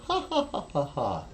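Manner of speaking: high mock ha-ha laugh; hammed-up polite laugh; imitated laughter. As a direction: act out the women laughing. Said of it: "And then they go".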